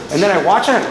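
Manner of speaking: normal talking voice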